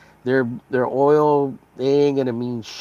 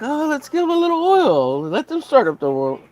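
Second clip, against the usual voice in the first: high, simpering mock voice; mocking Democrats; 13 semitones higher than usual. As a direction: mimic naive generosity, pitched up. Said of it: "they'll be like".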